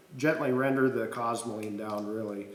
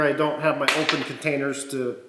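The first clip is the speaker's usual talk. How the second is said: ordinary talk, nothing marked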